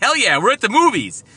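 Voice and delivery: put-on high, loud hyped-up kid; pumped kid voice. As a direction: shout it like a thrilled kid. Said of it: "May May's like".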